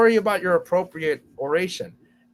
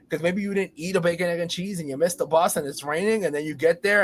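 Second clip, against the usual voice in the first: ordinary talk, nothing marked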